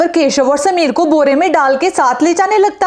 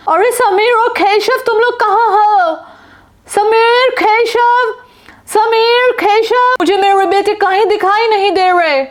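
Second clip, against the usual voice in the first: much higher, calling out her sons' names; frantic mother calling; 6 semitones higher, slower than usual. Acted. Mother shouts for her missing sons across the park, frantic. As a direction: search the park calling for them.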